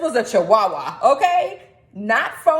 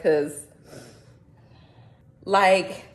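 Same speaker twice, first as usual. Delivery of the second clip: ordinary talk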